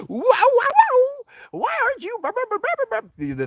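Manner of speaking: very high, loud hyper gushing; hammed-up squealing excitement, running into blahs; tone-deaf mock exuberance. Imitates self being tone-deaf to grief.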